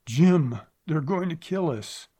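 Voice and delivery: loud, high panicked cry; panicked cry to her husband; choked fear. A narrator's reading. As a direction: gasp it out in terror. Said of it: "Mary Sloan choked".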